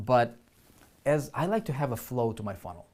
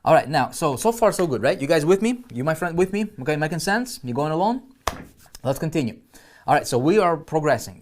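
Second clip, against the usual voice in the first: higher and louder, rallying the class; upbeat check-in; 5 semitones higher, 9 dB louder than usual. Real, not acted. Teacher rallying students mid-lesson, high energized voice. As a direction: pump up the room, checking they follow.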